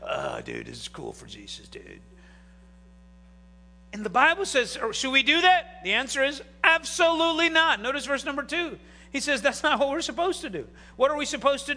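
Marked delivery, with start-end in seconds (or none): 0.1-2.0 very low, soft, laid-back stoner voice; mock a blissed-out stoner, trailing away; stoner dude. Churchgoer at lax church passing the joint, stoned. 6.6-7.8 high, forceful "absolutely not"; emphatic refusal. Acted. Preacher answering whether to keep sinning, emphatic.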